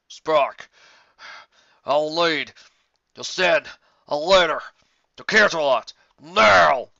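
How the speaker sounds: loud, urgent, slowed demand; out-of-breath pony demanding Spike send a letter; urgent shout. Acted. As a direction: bark out the urgent order.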